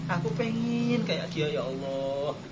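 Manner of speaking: slow mock-tearful plea, fading soft; pretend crying. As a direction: beg forgiveness as if weeping. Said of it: "pura-pura nangis".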